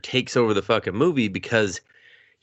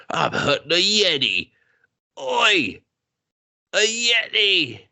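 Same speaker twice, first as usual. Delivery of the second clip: hammed-up Australian hunter voice, higher; mock Australian accent; 9 semitones higher, slower than usual. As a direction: play a cocky Australian Yeti hunter. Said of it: "his Australian accent was way good".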